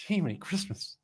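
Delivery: breathy, high little gasp of surprise; a soft cartoonish "Jiminy Christmas"; quiet silly exclamation. Flustered exclamation after laughing.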